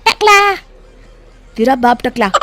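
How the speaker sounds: put-on high, loud kid-brother jeer; mocking taunt. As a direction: jeer loudly as a parting shot. Boy taunts on his way out. (चिढ़ाते हुए ऊँची आवाज़ में)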